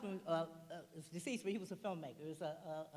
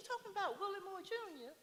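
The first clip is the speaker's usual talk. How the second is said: imitating Iyanla; 13 semitones higher than usual. Quoting Iyanla, a much higher surprised question. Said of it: "And she says".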